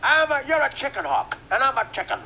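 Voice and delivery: high, loud cartoon rooster bluster; hammed-up preacher-rooster voice; Sharpton Leghorn. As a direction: boom it out like a blustering cartoon bird. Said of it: "Sharpton Leghorn".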